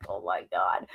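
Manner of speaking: low, soft, stunned "Oh my God"; stunned hush. Retold or imitated. To herself at the news, a low stunned hush. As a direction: let it drop out of you in disbelief. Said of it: "I'm like".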